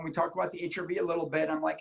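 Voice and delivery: ordinary delivery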